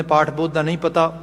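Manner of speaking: usual speaking voice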